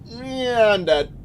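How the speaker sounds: ordinary talk, nothing marked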